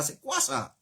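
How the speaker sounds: usual speaking voice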